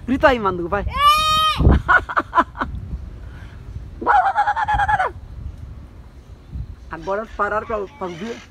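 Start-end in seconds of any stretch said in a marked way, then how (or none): 0.9-6.9 long high giggling run; long laugh. Real, not acted. Laughing at the shrieking parakeets, long and high.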